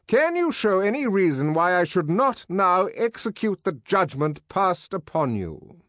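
high, loud, formal denunciation; pompous director. Acted. Director publicly condemning Bernard, high and imperious. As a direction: condemn him before the whole room.

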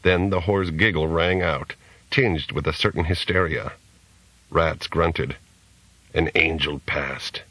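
ordinary delivery

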